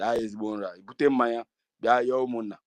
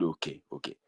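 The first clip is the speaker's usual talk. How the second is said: imitating the pilot; 5 semitones lower, 10 dB quieter than usual. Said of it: "And he said".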